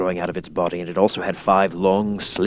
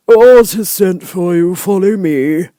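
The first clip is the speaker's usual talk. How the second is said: high, slow, loud soldier; louder, higher soldier calling the Woodman; 11 semitones higher, 10 dB louder, slower than usual. Acted. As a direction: announce it like a stiff palace guard. Soldier voiced in story.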